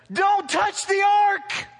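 slow, loud, high shout of the command; shouted warning. Acted. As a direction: thunder it at the congregation. Preacher repeats command at full force.

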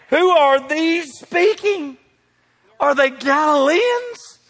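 high, loud, drawn-out questions; mock amazement. Amazed crowd asks about the speakers, loud high disbelief. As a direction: act out an astonished crowd, stretching the words.

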